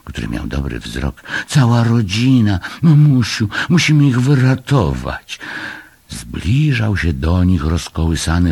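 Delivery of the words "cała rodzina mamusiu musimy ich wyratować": high, loud plea to mother; urgent yell. A narrator's reading. Son urging his mother to rescue a family, urgent yell. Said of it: "wrzasnął Muminek".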